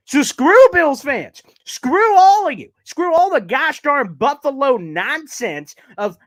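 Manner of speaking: heated shout. Real, not acted. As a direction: yell it with real passion. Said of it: "start this with such heat, such passion".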